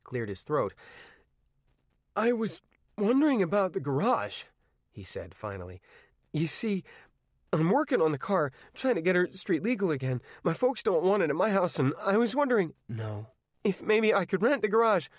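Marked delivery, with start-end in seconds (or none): 2.1-4.4 put-on nervous teenage boy asking; hesitant teen. Arnie asks hesitantly. 6.3-15.1 put-on nervous teenage boy asking; hesitant teen. Arnie pleads for the garage.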